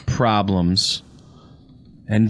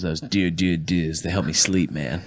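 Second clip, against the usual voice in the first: much lower and softer mock Berman voice, fast; mocking Chris Berman; 7 semitones lower, 5 dB quieter than usual. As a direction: do a dopey low Berman impression. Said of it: "in his stupid accent".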